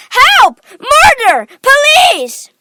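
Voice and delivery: high, loud screams; Missus Gloop. A narrator's reading. Mother screaming for help and police. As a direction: scream each word in alarm. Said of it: "screamed Missus Gloop".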